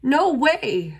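high, loud refusal; shouting. A narrator's reading. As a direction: bark out the refusal. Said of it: "shouted Bear".

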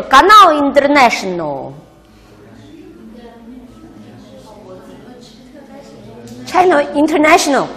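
put-on very slow Russian-accented Canal International; Russian English accent. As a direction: imitate a Russian saying International. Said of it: "俄罗斯人的英语啊都是这样".

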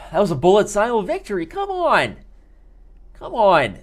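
excited outburst. Real, not acted. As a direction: shout it out, demanding credit. Celebrating ignored win.

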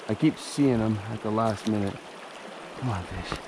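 ordinary talk

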